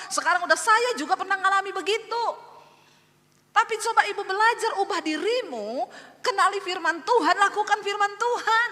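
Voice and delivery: high, loud, urgent exhortation; full-force preaching. Real, not acted. Pastor pleading with a wife to change, full-force preaching. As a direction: drive each plea home from the pulpit.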